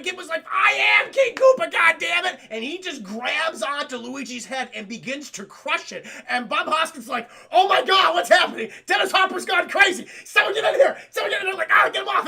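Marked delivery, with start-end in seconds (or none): 0.5-2.4 high, loud villain outburst; hammed-up furious shout. Acted villain explodes at the heroes. 7.5-11.6 high, loud hammed-up panic; mock panicked shouting. Acted panic as Hopper goes crazy. 11.7-12.3 high, loud pretend alarm; mock panicked yell. Acted crew pulling attacker off.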